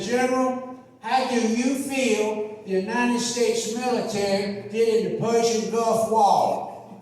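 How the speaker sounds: put-on slow, drawling old senator voice; lightly voiced elderly senator asking slowly; a hint of an old Southern senator, slowed down; Thurmond impression. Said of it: "But he said".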